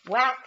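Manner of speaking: loud sound-effect whack. A narrator's reading. Teacher shouting the bat's whack to her class. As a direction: smack it out like a bat hitting. Bat hits ball in story.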